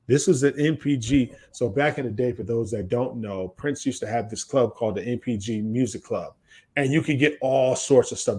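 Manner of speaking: ordinary talk, nothing marked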